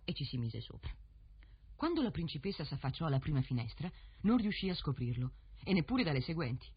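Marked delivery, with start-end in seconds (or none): none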